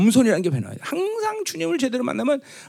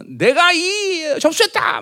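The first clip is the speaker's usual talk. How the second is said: high, drawn-out triumphant proclamation; conqueror's big boast; 8 semitones higher, much slower than usual. Acted. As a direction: boom it out like a conqueror claiming power. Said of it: "막 그런 큰 소리 칠 거 아니야".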